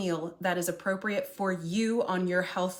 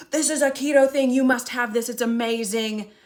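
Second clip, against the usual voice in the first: loud, high, pushy proclamation; mock rooftop shouting; 5 semitones higher, 7 dB louder than usual. Keto convert pushing food on the family, mock shouting. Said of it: "just shouting it from the rooftops".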